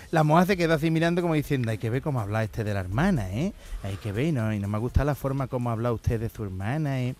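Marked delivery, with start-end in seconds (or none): 1.7-7.1 disapproving nun. Acted. Nun scolding man silently.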